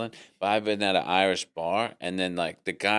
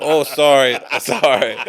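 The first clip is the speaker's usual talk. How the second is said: loud, high, laughing apology; mock apology; 5 semitones higher, 11 dB louder than usual. Caught copying someone's accent, loud laughing apology. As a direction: blurt an embarrassed sorry through laughter. Embarrassed at having mimicked someone.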